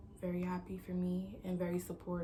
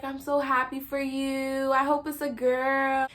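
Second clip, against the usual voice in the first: higher, louder, drawn-out delight; mom's happy gushing; 7 semitones higher, 8 dB louder, slower than usual. Retold or imitated. Mom congratulating her pregnant daughter, slow excited gushing. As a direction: gush slowly, imitating a delighted mom.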